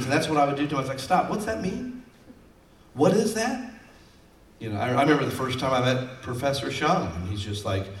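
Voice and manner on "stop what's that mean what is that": high-pitched, drawn-out questioning; mock-clueless questions with the pitch lifted; puzzled interruption. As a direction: break in as if totally lost. Interrupts the pastor, acted.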